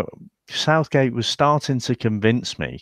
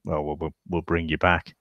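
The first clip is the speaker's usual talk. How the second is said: a touch of mock sheepishness, softer and lower; half-hearted sheepish climbdown; 4 semitones lower, 6 dB quieter than usual. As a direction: mumble it like a manager backing down. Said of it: "sheepishly like".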